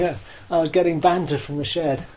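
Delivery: ordinary delivery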